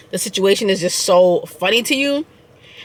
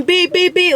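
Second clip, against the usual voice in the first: car horn imitation; 8 semitones higher than usual. Mimicking horns behind the reversing car, high beeps. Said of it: "just all these horns beeping".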